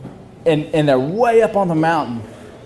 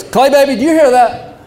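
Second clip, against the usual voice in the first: dad calling; 9 semitones higher than usual. Retold or imitated. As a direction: call out to your son in the dark. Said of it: "He goes".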